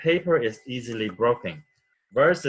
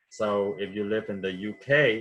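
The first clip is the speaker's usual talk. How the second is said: ordinary delivery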